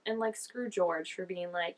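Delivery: ordinary delivery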